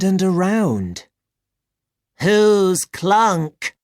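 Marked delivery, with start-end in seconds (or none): 2.2-3.8 high, blank robot voice; confused robot. Acted. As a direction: ask like a robot that forgot itself. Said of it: "said the robot".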